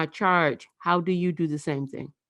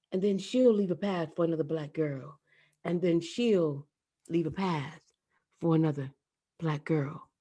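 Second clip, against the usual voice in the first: hushed closing echo; fading poem refrain; 6 dB quieter than usual. Acted. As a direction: let the refrain die away.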